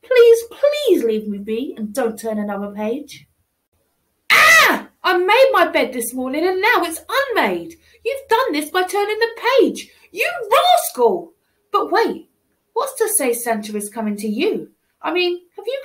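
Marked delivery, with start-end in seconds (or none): 4.3-4.9 high loud yelp; sharp high cry of shock; startled shout. Acted. Child finds unmade bed. 10.1-11.3 playful scold. Acted. Child scolds the reader.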